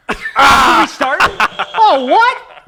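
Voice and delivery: slow, loud, rising to a laughing squeal; laughing surprise. Real, not acted. Player realises the game already started, laughing. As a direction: caught off guard and cracking up.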